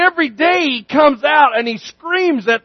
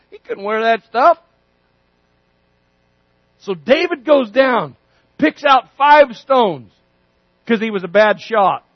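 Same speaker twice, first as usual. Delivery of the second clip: ordinary talk, nothing marked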